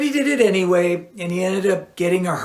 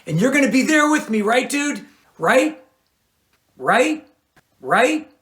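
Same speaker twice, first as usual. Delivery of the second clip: higher, fast, insistent right?; pleading for agreement; 9 semitones higher than usual. A narrator's reading. Stevens begging Lear to stay with him, pleading. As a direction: keep asking, needing a yes.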